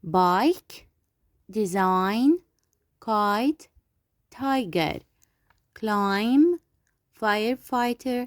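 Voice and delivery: clear, separate words for the class to repeat; model pronunciation drill. Shown as a demonstration. English teacher reading vocabulary aloud for repeating. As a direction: say each word slowly for pupils to echo. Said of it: "Listen, point and repeat".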